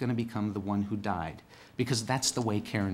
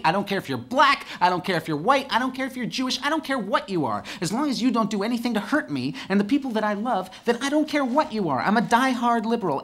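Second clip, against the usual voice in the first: escalating, higher and louder with each I don't care; rising rant; 9 semitones higher, 5 dB louder than usual. Real, not acted. Comic to the crowd, ranting louder and higher. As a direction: build the rant line by line to a peak.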